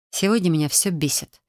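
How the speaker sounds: ordinary delivery, nothing marked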